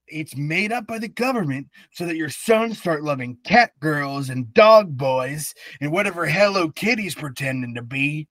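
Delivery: shouting, high conspiracy rant; conspiracy theorist. Pretend. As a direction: rant like a paranoid truther.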